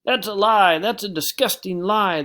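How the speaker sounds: loud, high, outraged shout; storybook turtle shouting in outrage. A narrator's reading. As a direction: yell it as an angry turtle. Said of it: "the turtle shouted".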